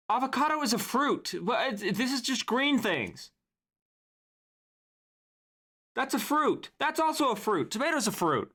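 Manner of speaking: high, indignant fruit rant; exasperated rant. Real, not acted. Streamer ranting at the vegetable tier list, exasperated. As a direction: insist these are fruits, fed up.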